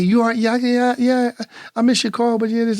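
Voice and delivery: put-on high, casual girl voice; breezy girlfriend. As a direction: brush it off lightly. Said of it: "She just like".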